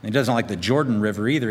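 usual speaking voice

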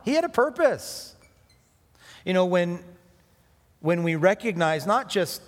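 rising fervent pulpit climb; preaching at full force. Acted. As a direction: build the sermon to a ringing high. Preaching crescendo.

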